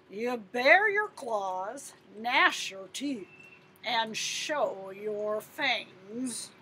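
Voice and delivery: slow, drawn-out commands from a lion; slow lion instructions. Acted. Lion coach teaching the boy to look fierce, slow. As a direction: spell out each step slowly.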